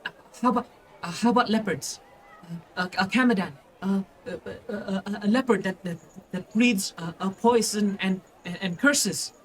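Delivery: put-on high, stammering little kid; shaking small child. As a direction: stumble over the words in a frightened child's high voice. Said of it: "like, shakes and he just goes".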